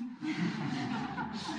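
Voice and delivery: breathy laugh. Real, not acted. Laughs at own parody.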